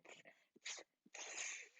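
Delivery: breathy hissed tsks; monkey tsk tsk. Acted. Monkeys copying the peddler with hissed tsks. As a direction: hiss it back like copycat monkeys. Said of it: "and said".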